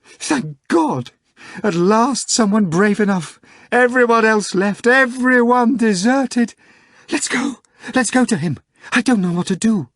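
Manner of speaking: high, loud, frantic wailing; hysterical secretary screaming. A narrator's reading. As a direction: cry out in a panic, begging for help. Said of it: "began to shake him and scream".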